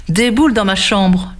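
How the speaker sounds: ordinary delivery, nothing marked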